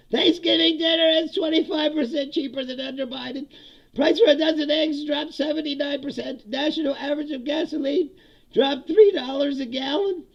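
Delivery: high, loud sing-song mockery of the official claims; mocking read. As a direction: read it like a ridiculous ad.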